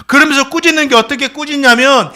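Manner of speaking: ordinary talk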